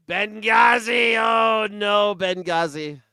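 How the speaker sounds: loud, slow shouted cry of Benghazi; mock panic shout. Parodying the Benghazi outrage, loud slow shout. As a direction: howl it like a mock alarm.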